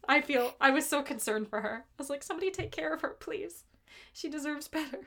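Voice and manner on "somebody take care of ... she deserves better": higher and much softer, pleading gently; soft pleading concern. Retold or imitated. Fan pleading softly for Donna to get care. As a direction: plead softly for someone to look after her.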